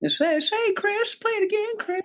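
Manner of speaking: very high, put-on catchphrase voice; impression of TV host. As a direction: do a squeaky comic impression. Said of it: "wanted to do my impression then".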